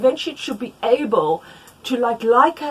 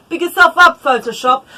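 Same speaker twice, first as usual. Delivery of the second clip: self-hype; 7 semitones higher, 11 dB louder than usual. Acted. Hyping up her own photoshopped chart.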